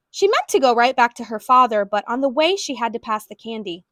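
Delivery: plain reading voice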